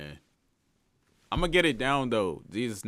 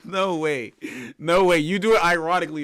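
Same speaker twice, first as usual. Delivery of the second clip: high, loud burst of disbelief; incredulous; 7 semitones higher, 12 dB louder than usual. Real, not acted. Streamer asking chatter if it's a joke, incredulous. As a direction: blurt it out, loud and pitched up.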